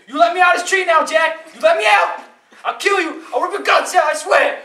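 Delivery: high, loud enraged shouting; furious threats. Acted. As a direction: yell like a trapped, raging devil. Trapped devil rages at Jack again.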